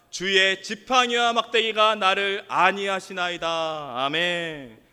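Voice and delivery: slow, low congregational reading aloud; scripture reading. Real, not acted. Preacher leads the congregation in the Psalm, reading solemnly. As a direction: read the verse in measured, solemn phrases.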